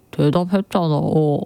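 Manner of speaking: brother's low grumbled retort; mock sullen reply in a dropped pitch; imitated low voice. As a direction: answer back low, as a teenage brother. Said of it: "그 낮은 저음으로".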